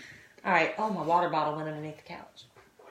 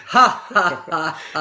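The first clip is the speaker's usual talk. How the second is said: loud hammed-up ha ha ha; mocking her husband's fake laugh, loud hammed-up; 8 dB louder, slower than usual. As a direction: imitate his fake laugh, loudly. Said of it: "That was the fakest laugh".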